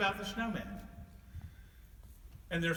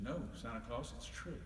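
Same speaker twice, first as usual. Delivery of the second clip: put-on old snowman voice; 6 semitones lower, 9 dB quieter than usual. Old snowman answering Santa, low and soft. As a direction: lower and softer old snowman.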